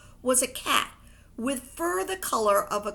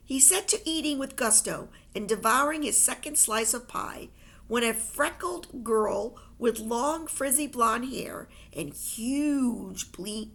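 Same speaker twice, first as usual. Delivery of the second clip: ordinary delivery, nothing marked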